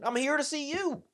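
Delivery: very high, squeaky retold reply; comically high-voiced exclamation; high-pitched exclamation. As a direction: squeal it as if the answer were obvious. Acts out his incredulous answer.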